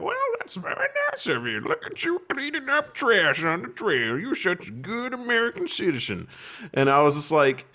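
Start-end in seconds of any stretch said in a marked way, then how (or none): 0.0-6.3 sweet passerby voice. Retold or imitated. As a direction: gush like a kindly stranger on the trail. Passersby praising trash pickup.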